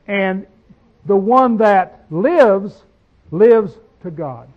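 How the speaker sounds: solemn verse reading; slow scripture reading. Acted. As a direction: weigh every word of the verse. Reading verse ten slowly.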